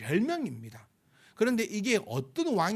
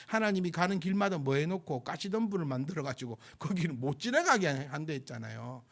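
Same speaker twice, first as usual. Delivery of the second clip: normal talking voice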